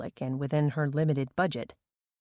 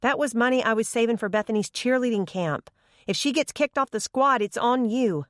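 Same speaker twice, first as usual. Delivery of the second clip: higher, aggrieved scolding voice; exasperated woman; 7 semitones higher than usual. Acted. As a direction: scold him, then hurry off. Upset caller on the phone.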